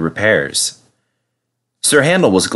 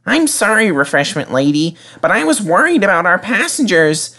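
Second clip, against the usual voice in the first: put-on higher apologetic young engine voice; little engine; 10 semitones higher than usual. A narrator's reading. Peter Sam apologising to the refreshment lady, sorry little engine. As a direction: sound small and sorry.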